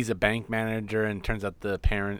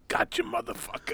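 mock gloating; 6 dB quieter than usual.

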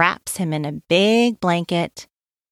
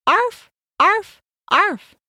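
high yappy arf arf arf; puppy barking; 12 semitones higher than usual. A narrator's reading. Narrator barks as the puppy Max, high and yappy. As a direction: bark like a little puppy for the kids. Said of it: "as the dog begins to bark".

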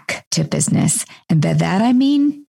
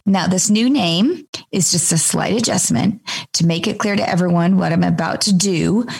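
usual speaking voice

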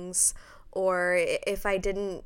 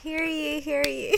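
lightly voiced town crier call; 7 semitones higher than usual. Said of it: "town crier".

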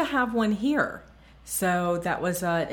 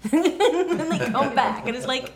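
laughing; 5 semitones higher, slower than usual. Real, not acted. Laughing while telling tease.

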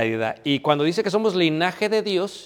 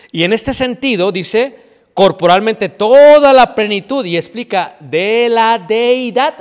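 preaching emphasis; 6 semitones higher, 10 dB louder than usual. Acted. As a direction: lift the verse up to the congregation. Preacher reads verse emphatically.

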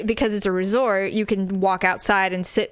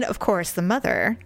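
ordinary delivery

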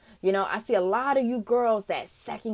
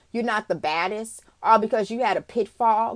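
ordinary talk, nothing marked

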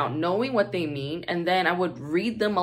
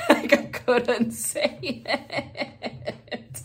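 squeaky high laugh through the words; 10 semitones higher, much slower than usual. Real, not acted. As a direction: laugh it out helplessly. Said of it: "laughing while telling".

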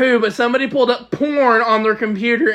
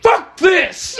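mock outburst; 9 semitones higher, 4 dB louder, much slower than usual. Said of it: "he goes".

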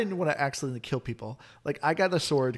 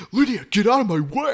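higher, louder mock shout; imitated exasperated yell; 6 semitones higher, 7 dB louder than usual. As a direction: mimic a player yelling at a follower. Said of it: "I constantly hear you".

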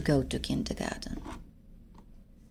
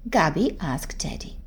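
plain reading voice

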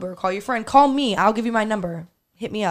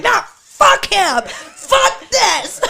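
much higher, louder, slow laughing yell; mock defiant shout; 11 semitones higher, 10 dB louder, much slower than usual. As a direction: scream defiance through laughter. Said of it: "and I was like".

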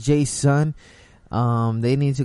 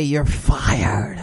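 lightly voiced wrestling boss firing someone; Vince McMahon. Host jokingly fires the tired weatherman, mock boss voice. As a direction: do a hint of a boss impression, drawn out on "fired". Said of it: "put my Vince McMahon voice and say".

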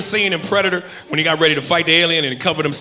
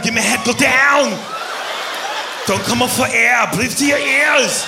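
strained action-movie command; Arnold Schwarzenegger impression; 5 semitones higher, slower than usual. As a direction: order it with drawn-out, strained vowels. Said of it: "I actually met Arnold Schwarzenegger".

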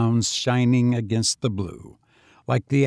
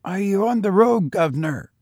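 put-on higher voice of a street boy; a tramp boy calling out, higher; lightly voiced husky boy; young boy; 8 semitones higher than usual. A narrator's reading. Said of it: "asked the boy huskily".

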